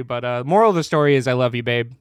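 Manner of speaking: ordinary delivery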